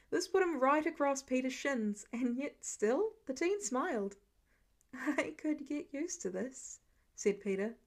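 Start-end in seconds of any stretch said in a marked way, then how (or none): 4.9-6.8 contented sleepy murmur. Acted.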